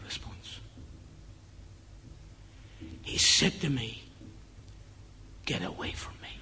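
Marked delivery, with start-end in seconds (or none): 5.4-6.3 breathless whisper, barely voiced; retold whisper. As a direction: breathe the words out like a dying man. Dying man rejects the pastor.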